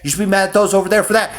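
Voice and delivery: a crowd of voices goading him toward anger; mocking nagging voice, rising. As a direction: needle him to be mad, pushing harder. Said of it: "everybody's like".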